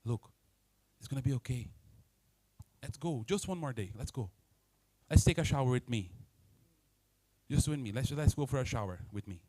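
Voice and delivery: put-on soft, low comforting voice to a child; gentle parent. The parent gently comforts the crying child, soft and low. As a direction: coax the child softly along. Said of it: "be there for them and say".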